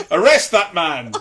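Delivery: loud, high, drawn-out comic command; mock-outraged bellow, played for laughs; mock police shout. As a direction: shout a hammed-up order to arrest someone. Joking mock order to arrest patient.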